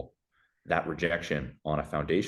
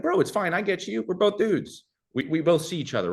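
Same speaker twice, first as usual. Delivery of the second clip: much higher, easygoing bro voice; mock bro reassurance; 12 semitones higher than usual. Guy reassuring another guy about a confession, high-pitched mock reassurance. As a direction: let him off the hook, pitched way up.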